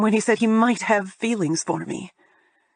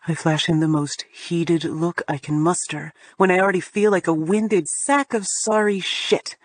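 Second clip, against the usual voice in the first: ordinary delivery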